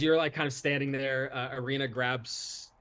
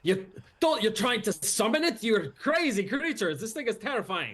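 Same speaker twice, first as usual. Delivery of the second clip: higher, louder, horrified; alarmed; 9 semitones higher, 4 dB louder than usual. Acted. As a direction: recoil from the crazy idea. NPC alarmed at players.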